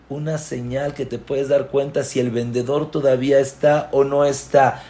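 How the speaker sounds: usual speaking voice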